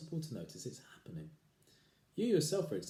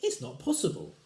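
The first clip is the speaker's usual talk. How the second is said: protesting wife; 4 semitones higher, 7 dB louder than usual. Acted.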